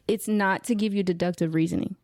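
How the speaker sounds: usual speaking voice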